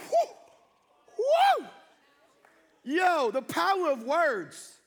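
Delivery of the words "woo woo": high, loud hoot; short piercing "woo" of exhilaration; loud whoop. Real, not acted. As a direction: whoop it out after the big shout. Whooping after the shouted rebuke.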